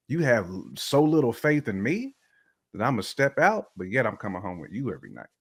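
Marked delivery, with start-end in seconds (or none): none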